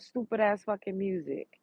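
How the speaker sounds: ordinary delivery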